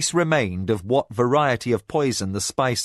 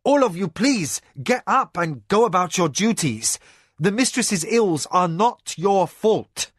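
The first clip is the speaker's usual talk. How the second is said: raised command; 7 semitones higher than usual. A narrator's reading. Master kindly ordering servants up.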